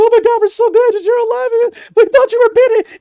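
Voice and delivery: very high, loud frantic relatives; relieved family. Acted. Family gathering round the rescued kid, loud squealing relief. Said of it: "family, assumingly, uh, they gather around him like".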